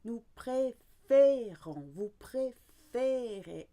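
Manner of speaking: préférons and préférez said slowly, with a clear closed E "ay"; slow clear E sound. Shown as a demonstration. Teacher drilling the closed E for learners. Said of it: "now you're gonna go to E".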